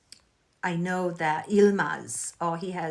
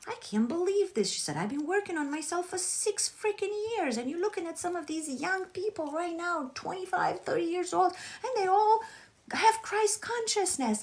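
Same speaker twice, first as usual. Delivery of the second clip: much higher, playful imitation of an exasperated fan; quoting a fan post; 11 semitones higher than usual. Retelling a viewer's post to the audience, high mock exasperation. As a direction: mimic her frustration in a high voice.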